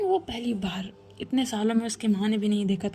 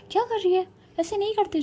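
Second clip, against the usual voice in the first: higher, alarmed, quick; alarmed scolding; 10 semitones higher than usual. Acted. Mother startled as daughter hits the bench, alarmed. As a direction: stop her hurting herself.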